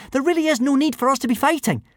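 quick, high breathless plea. A narrator's reading. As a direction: reason hurriedly while short of breath. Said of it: "asked Hiccup breathlessly".

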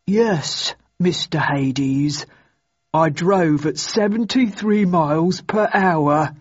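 slow, obedient dimwit voice; plodding, dutiful stupid sidekick; dim-witted henchman. A narrator's reading. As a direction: answer the boss like a slow-witted lackey. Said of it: "matched only by his stupidity".

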